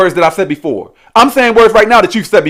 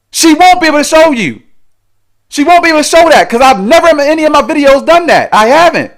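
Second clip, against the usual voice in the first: high-pitched, heated insistence; indignant denial; 7 semitones higher, 3 dB louder than usual. Real, not acted. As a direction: shut the accusation down emphatically. Indignantly denying her accusations to viewers.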